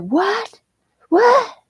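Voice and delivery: high-pitched mock "what?", rising sharply; incredulous customer. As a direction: imitate a customer squawking in disbelief. Retold outraged old customer.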